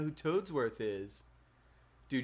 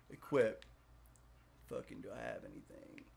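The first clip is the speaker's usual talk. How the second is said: ordinary delivery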